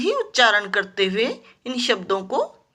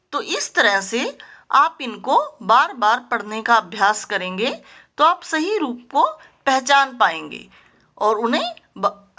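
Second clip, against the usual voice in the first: normal talking voice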